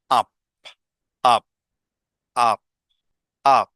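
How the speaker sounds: clipped "আপ" said again and again, jaw dropping; repeated up sound. Shown as a demonstration. Teacher drilling the vowel in umbrella. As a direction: drill the short u vowel in a beat. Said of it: "খুব এভাবে আ".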